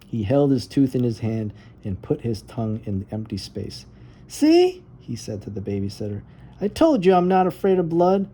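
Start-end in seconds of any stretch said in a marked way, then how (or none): 4.3-8.3 put-on high, louder triumphant child; proud boast. Arthur boasting to the babysitter, high and proud. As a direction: show off, thrilled.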